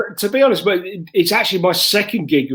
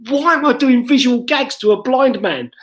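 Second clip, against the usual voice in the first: comic catching his own mistake on stage; self-mocking realization; 9 semitones higher than usual. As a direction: blurt it out mid-set. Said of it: "I just turned and go".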